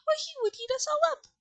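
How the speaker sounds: very high, fast mouse panic; alarmed cry. A narrator's reading. Queen of Mice fears the lion, fast alarmed squeal. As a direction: rush it out as a frightened mouse queen. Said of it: "cried the little Queen".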